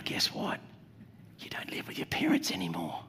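sly whispered tease; conspiratorial whisper. Pretend. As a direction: whisper it like a secret. Teasing freebies, sudden whisper.

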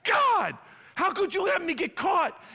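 high, loud whiny protest to God; a grounded boy wailing at God; kid's angry cry. Retold or imitated. Pastor replaying his childhood anger at God, high whiny wail. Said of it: "I was".

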